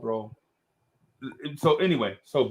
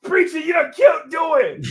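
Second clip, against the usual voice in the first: high, loud shouted joking alarm; mock alarmed shout; 14 semitones higher, 7 dB louder than usual. Friends joke with Preacher, loud mock-panicked shout. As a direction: yell it like he really died. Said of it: "I'm like".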